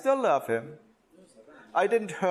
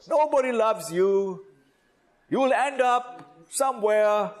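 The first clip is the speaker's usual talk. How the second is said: higher, louder scolding, as he might have shouted; angry shout; 8 semitones higher, 4 dB louder than usual. Pretend. Father shouting at his little boy over the broken bike, angry scolding. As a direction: pretend to lose your temper and berate the boy. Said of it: "I could have shouted at him".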